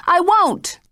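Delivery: cling to her refusal; panicked refusal. Acted.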